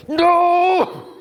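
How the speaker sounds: sharp high shouted no; a sudden high, loud no; aggressive shout. Retold or imitated. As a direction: bark it at her, stressed out. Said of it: "Really aggressively".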